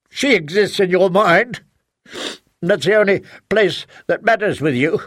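wound him with every line; bitter accusation. Acted. Wife keeps attacking husband.

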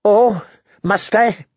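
scornful drawn-out snarl. A narrator's reading. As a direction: snarl it back at him. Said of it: "Tyrion snarled".

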